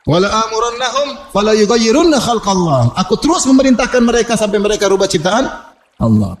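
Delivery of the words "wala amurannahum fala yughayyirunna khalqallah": Qur'anic recitation. Acted. Preacher answering who gave the order, higher verse recitation. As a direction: chant the verse with long held vowels.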